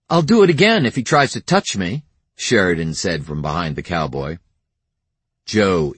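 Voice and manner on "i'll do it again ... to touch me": fast, high, fierce; defiant. A narrator's reading. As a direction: fire it back fast and high. Sheridan defiant behind cowboy.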